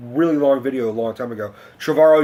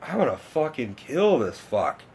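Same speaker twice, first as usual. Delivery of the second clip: slow, softer simmering threat; held-back mock fury, words drawn out; retold seething; 5 dB quieter than usual. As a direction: imitate quietly seething with rage. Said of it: "just, like, seething".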